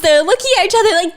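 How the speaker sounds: usual speaking voice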